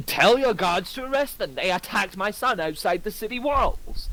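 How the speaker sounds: high demanding noble voice; angry lord. Acted. Lucius demanding McCall arrest them, high voice. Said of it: "turn to McCall and say".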